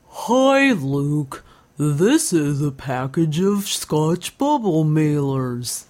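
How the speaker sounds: goofy, slow, drawn-out character voice; swooping, slow cartoon greeting; invented street voice. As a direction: talk as a dopey city street, stretching each word. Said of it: "will always start off a conversation like this".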